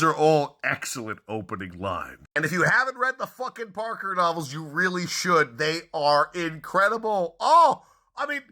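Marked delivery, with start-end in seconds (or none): 2.3-8.4 loud, high, fast gushing; pleading with fan fervor; excited rant. Real, not acted. Enthusiastic plea to read the novels.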